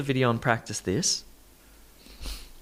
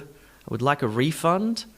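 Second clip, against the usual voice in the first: refund said REE-fund, as a noun; noun stress on first syllable. Shown as a demonstration. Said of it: "Refund is the noun".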